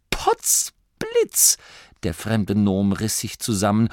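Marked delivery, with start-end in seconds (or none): 0.1-1.6 a squeaky gasp of wonder; sudden high astonished cry; astonished exclamation. A narrator's reading. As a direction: shoot way up in amazement.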